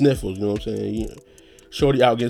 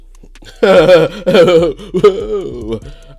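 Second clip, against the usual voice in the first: loud laugh; 9 semitones higher, 10 dB louder than usual. Real, not acted. Laughing at his own joke.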